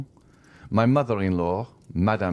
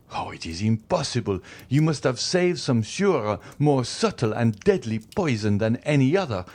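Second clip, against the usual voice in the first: increasing excitement; 8 semitones higher than usual. A narrator's reading. Magistrate accuses his poisoner wife, high excited tirade. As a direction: build the accusation into a fevered rant. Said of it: "with increasing excitement".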